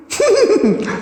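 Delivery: high, wild laugh; mad guffaw. A narrator's reading. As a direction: cackle madly. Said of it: "She guffawed madly".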